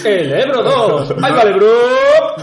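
high, loud, slow burst of laughter; cracking up at the pun; laughing loudly. Real, not acted. (riendo a carcajadas, aguda y fuerte)

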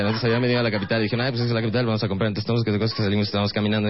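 put-on rambling girlfriend. Comedian mimics his girlfriend over-explaining a craving, fast rambling. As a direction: rattle on fast and a little low, piling up details.

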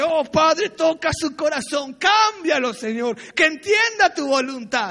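loud pleading prayer, rising to a shout on cámbialo; fervent loud prayer. Retold or imitated. Retelling his bold prayer over the pastor, loud and pleading. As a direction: cry out to God to change the pastor's heart.

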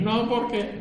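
high squeaky mock objection; mock protest. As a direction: imitate him protesting, high-pitched. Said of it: "me va a decir".

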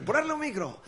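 high, hammed-up order; imitating director's order. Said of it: "Hostia, dice".

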